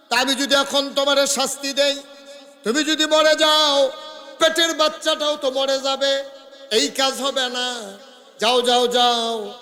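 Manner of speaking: loud, high chanted delivery; full-force preaching. Acted. Prophet reasoning with the woman, loud preaching chant.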